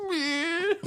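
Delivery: high-pitched "meh" sob; mock crying whimper. A rejected guy going home, mock crying. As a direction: squeal like you're starting to bawl. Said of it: "just go home like".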